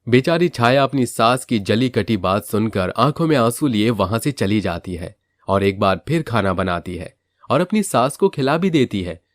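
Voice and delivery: plain reading voice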